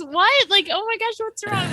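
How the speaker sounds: put-on high, startled little boy; surprised kid. Neighbor boy hearing bad news, high and startled. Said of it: "And he goes".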